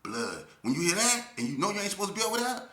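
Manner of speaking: ordinary talk, nothing marked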